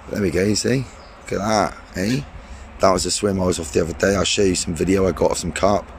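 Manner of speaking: ordinary delivery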